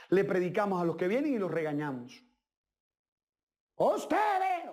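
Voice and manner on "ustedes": mock scold. Imitating scolding of the congregation.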